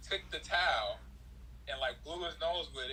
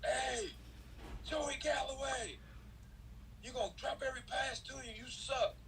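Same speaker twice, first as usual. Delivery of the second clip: much higher mock heckle; heckling fan; 11 semitones higher, 4 dB quieter than usual. A fan heckles him in warm-ups as Joey Galloway, high mock shout. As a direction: jeer at the player you think is Joey. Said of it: "I was in warm-ups".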